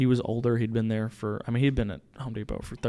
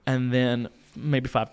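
normal talking voice

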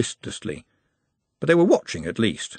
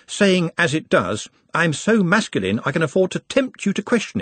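higher-pitched, precise little scholar; erudite Nut, earnest; 7 semitones higher than usual. A narrator's reading. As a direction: lecture eagerly with careful words.

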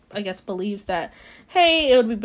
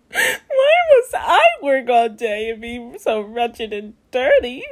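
slow, louder crying plea; sobbing; 2 semitones higher, 5 dB louder, slower than usual. Acted. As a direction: cry it out, slowly, through sobs. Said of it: "Cinderella sat down in the garden and sobbed".

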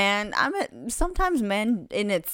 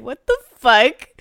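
shocked; 6 semitones higher, 9 dB louder than usual. Retold or imitated. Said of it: "I'd be like".

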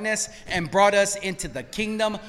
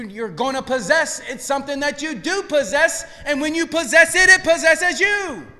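usual speaking voice

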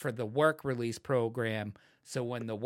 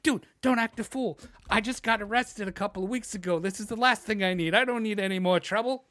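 higher-pitched, urgent plea to a friend; anxious, raised-voice reasoning; worried protest; 7 semitones higher, 5 dB louder than usual. Retold or imitated. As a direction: talk a reckless friend down, exasperated. Pleading with reckless friend.

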